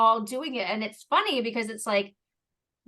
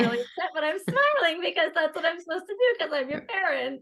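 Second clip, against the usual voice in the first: high, strained fake-smiling parent voice; mock forced cheer; 8 semitones higher, 3 dB louder than usual. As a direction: sound upset but pretend to smile. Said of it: "not like".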